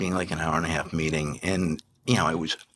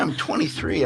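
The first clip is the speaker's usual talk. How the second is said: ordinary talk, nothing marked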